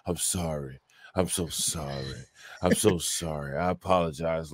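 low, hushed imitation of contrition; mock apology. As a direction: mimic a softly repeated fake apology. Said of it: "He's just like".

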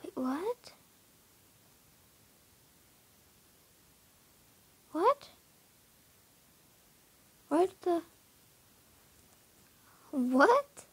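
sudden high-pitched confused what; baffled, can't believe the horse is gone; real surprise in the moment; startled disbelief. Real, not acted. Horse seems to have vanished.

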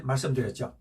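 ordinary talk, nothing marked

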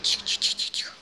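urgent whispered hush. Real, not acted. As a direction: shush them in a whisper. Hushing the others.

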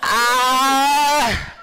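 loud high scream; panicking gamer scream. Real, not acted. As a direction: scream at the screen.

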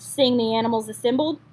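plain reading voice

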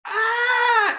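sudden high, loud shriek of fright; startled scream. Retold or imitated. Little boy frightened by the roach, high yelp. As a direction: yelp like a kid who's scared. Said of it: "I go from".

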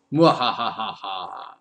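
slow evil villain laugh; mad scientist. Pretend.

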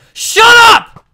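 angry outburst; furious shout. Real, not acted. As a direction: yell it at the screen. Yelling at game mob.

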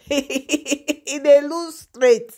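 slow, laughing through He dey lose straight. Real, not acted. Mocking the king to viewers, she laughs slowly. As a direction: chuckle as you drag the words out.

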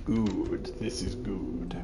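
low creepy whisper; sinister whisper. Acted.

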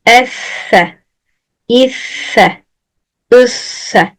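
"Esse, isse, üsse" with a flowing lisped s; lisped doubled s. Shown as a demonstration. Teacher showing lisped "Esse, isse, üsse" to students. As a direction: let the lisp flow on "Esse, isse, üsse". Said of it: "Hafif ne yaptım? Akıttım".

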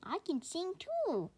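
high, slow, breathy happy line; happy rooster crow. Acted. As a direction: crow it happily. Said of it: "crows the happy rooster".

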